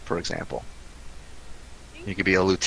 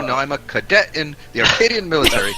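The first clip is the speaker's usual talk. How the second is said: indignant; 8 semitones higher, 4 dB louder than usual. Acted. Player in character, indignant cadet.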